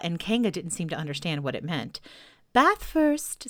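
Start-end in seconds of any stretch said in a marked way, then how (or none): 2.5-3.5 higher, louder motherly cheer; cheerful. A narrator's reading. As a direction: announce it brightly to a little one. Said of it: "said Kanga in a cheerful voice".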